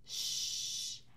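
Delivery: whispered shh of the owl; owl shush. Shown as a demonstration. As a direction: hush like an owl. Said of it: "la lechuza hace".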